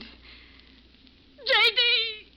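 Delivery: rising disbelief to a high call; shocked cry. Acted. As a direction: call out his name in horror.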